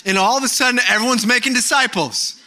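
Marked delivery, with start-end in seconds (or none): none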